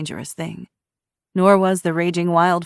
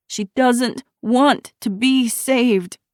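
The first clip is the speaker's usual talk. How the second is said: higher, slow, heavy; grim slow statement; 7 semitones higher, 5 dB louder, much slower than usual. A narrator's reading. Shiloh to Ruby, slow grim reply. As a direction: weigh every word.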